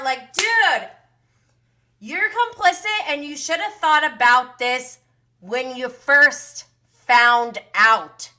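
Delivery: loud, high, exasperated telling-off; exasperated rant. Real, not acted. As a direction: call him out, fed up. Ranting at the TV cast member.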